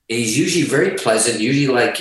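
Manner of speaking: ordinary talk, nothing marked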